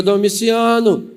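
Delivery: aunt's drawn-out summons to her husband; calling out. Acted. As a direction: call the name out firmly, stretched slow. Aunt first calls bad-tempered uncle.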